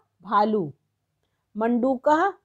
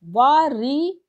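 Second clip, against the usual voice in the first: slow spelling out; 3 semitones higher, 4 dB louder, slower than usual. Shown as a demonstration. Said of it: "री की मात्रा नीचे लगी".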